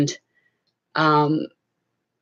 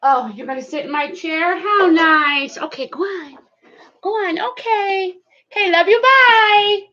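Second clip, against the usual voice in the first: high cooing voice shooing the dog away; pet talk; 14 semitones higher than usual. Real, not acted. Talking to the dog sweetly.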